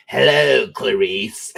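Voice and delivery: drawn-out, creepy movie-villain greeting; loud eerie hello, easing down on the name; Hannibal Lecter. Retold or imitated. Dad retelling daughter's dinner-table joke, creepy villain impression. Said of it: "she's Hannibal Lecter".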